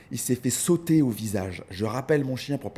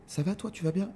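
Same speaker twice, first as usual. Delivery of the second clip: put-on gentle to child; 7 semitones higher, 4 dB quieter than usual. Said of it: "j'ai fait".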